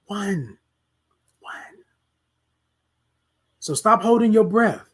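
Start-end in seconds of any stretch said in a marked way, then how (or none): 1.4-1.8 a breathy whispered "One.". Shown as a demonstration. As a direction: whisper "One." to drive it home. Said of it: "You only get one life".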